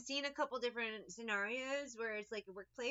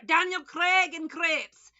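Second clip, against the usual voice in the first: slow, loud, higher mock Scottish "Daniel Craig and Crepes"; mock Scottish accent; 7 semitones higher, 13 dB louder, slower than usual. Showing the only phrase she can do, mock Scottish accent. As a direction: drag it out in a hammed-up Scottish accent. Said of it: "things I can say with a Scottish accent".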